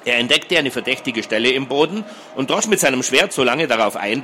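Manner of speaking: ordinary delivery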